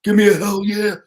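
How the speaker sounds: loud, high mock wrestler yell; shouted catchphrase. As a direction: belt it like a wrestling promo.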